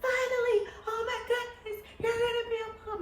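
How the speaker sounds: very high imitated shriek of joy; going ballistic. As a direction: squeal like an ecstatic girlfriend hearing big news. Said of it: "she was just going ballistic".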